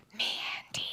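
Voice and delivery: drawn-out whispered name; soft, breathy stretched-out whisper; ASMR whisper. Shown as a demonstration. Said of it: "Mandy Rose A S M R entrance".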